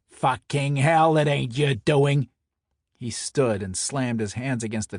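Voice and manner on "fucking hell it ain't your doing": loud, slow, disbelieving fury; heated Brooklyn snarl; angry outburst. Acted. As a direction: explode at him like an enraged mobster. Enforcer erupts in a quarrel.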